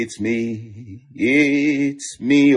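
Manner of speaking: usual speaking voice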